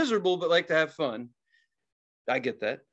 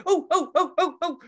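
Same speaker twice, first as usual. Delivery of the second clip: high excited oohs breaking into laughter; gleeful eavesdropper; 13 semitones higher, 3 dB louder than usual. Retold or imitated. Eavesdropper getting excited at a row, giddy hooting. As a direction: relish the drama with rising excitement. Acting his gleeful reaction to quarrel.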